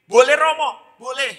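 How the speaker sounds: put-on high, loud child's reply; eager schoolchild. Boy lets priest question him, high eager child voice. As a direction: jump in keenly like a young pupil. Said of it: "Anak ini duduk misa semangat sekali".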